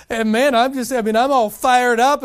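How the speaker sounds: fired up. Retold or imitated. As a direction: burst out all fired up. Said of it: "He goes".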